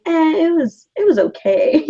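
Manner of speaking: high-pitched noncommittal "eh", then settling down; mock so-so reaction with a little laugh; mimicked lukewarm verdict. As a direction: imitate an underwhelmed friend, starting high.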